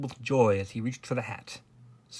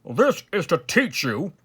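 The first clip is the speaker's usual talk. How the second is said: loud, higher royal reprimand; stern; 5 semitones higher, 7 dB louder than usual. A narrator's reading. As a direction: lay down the law like a king. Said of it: "his majesty said sternly".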